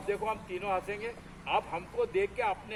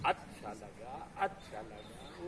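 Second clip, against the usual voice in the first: slow, soft, drawn-out chant; scandire la formula; 9 semitones lower, 8 dB quieter, much slower than usual. Shown as a demonstration. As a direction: stretch each word out, low and easy. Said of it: "क्या बोलना है?".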